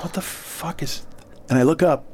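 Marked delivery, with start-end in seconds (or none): 0.0-1.0 slow, barely voiced disbelief; stunned whisper. Clerk noticing something else wrong, stunned whisper. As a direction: whisper it slowly to yourself.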